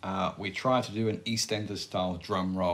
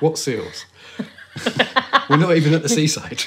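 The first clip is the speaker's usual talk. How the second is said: laughing; 7 semitones higher, 9 dB louder than usual. Real, not acted. Laughing through the line.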